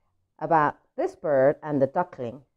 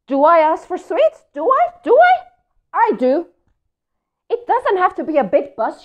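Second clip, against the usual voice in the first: higher, louder, ranting pigeon; complaining pigeon; 4 semitones higher, 6 dB louder than usual. Acted. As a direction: rant about everything you asked for. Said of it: "here is Pigeon complaining".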